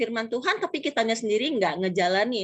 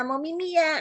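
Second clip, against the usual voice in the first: put-on parental baby talk; 8 semitones higher than usual. Parent offering a toddler a drink, exaggerated baby talk. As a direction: mimic a parent copying toddler speech. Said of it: "kita orang tuanya ikutan".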